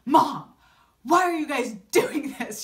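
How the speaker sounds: loud, exasperated mock outburst; punchy indignant protest; pissed off. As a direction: imitate an angry daughter confronting family. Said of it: "She is pissed".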